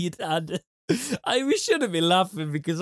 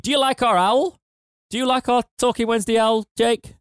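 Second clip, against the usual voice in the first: pitched up, a bit louder, teasing the owl plug; playful excited; 8 semitones higher, 3 dB louder than usual. Acted. Host teasing co-host during the owl sponsor read, playful and excited. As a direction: sell the mascot with mock enthusiasm.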